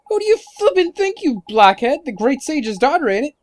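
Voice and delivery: high, emphatic fairy voice, indignant; exasperated sidekick scolding a blockhead; excitable fairy sidekick. Acted.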